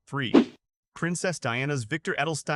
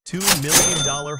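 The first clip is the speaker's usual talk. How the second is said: loud announcing; 3 semitones higher, 9 dB louder than usual. Real, not acted. Price announced.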